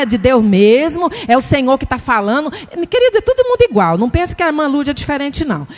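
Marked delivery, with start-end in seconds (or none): none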